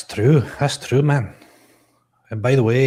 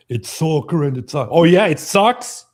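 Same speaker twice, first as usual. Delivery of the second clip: mocking American fan; 6 semitones higher, 8 dB louder than usual.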